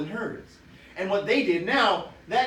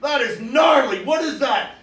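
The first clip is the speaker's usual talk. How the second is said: shout; 7 semitones higher, 10 dB louder, slower than usual. Acted. Badger shouting at termite house.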